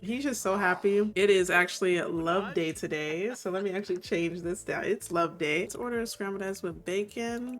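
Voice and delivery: ordinary talk, nothing marked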